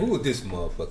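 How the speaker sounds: put-on low, soft, puzzled kid muttering; low hushed "who is this" from the back row; mock kids' voice. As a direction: play the class eyeing a stranger.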